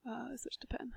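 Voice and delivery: whispered under the breath; muttering a note to self, barely voiced; whispered aside. Real, not acted. Tutor whispering a reminder to self mid-lesson, under the breath.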